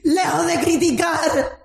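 high, fervent preaching cry; preaching at full force. Acted. Preacher rousing the congregation, fervent high cry. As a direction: lift it up and preach it to the church.

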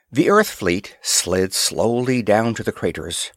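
ordinary delivery, nothing marked

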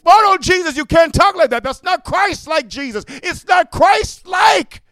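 high, loud whining protest, mocking them; mock outraged churchgoer, high and loud. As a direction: squeal the complaint like an offended churchgoer.

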